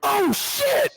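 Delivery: very high squeaky oh shit; kid's shocked curse. Retold or imitated. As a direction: imitate a stunned ten-year-old. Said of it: "He went".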